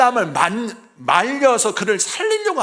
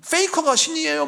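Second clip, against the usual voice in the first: put-on higher, taunting kid's voice; cheeky child; 10 semitones higher than usual. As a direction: tease like a smug kid. Mocking child's retort.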